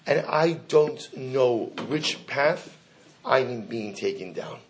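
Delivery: very slow, sinking delivery; slow right down and sink on the ending; grave uncertainty. Retold or imitated. Dying rabbi unsure of his path, slow and grave.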